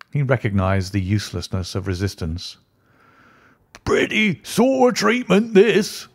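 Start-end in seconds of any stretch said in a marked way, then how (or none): 3.8-6.1 put-on high, slow indignant old man crying out; wronged old man stamping his stick. A narrator's reading. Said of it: "he cried".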